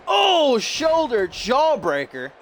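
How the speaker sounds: high, sudden "oh" of excitement; excited reaction. Real, not acted. Commentator reacting to a shoulder jawbreaker, excited cry. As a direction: cry out at the big hit.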